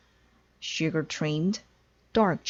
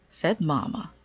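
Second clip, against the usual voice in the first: ordinary delivery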